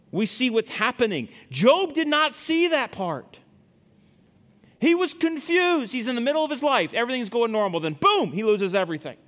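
high, loud, impassioned preaching; preaching at full force. Acted. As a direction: drive the point home with fervor. Preaching climax, much higher.

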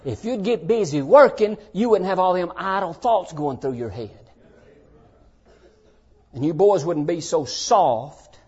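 ordinary talk

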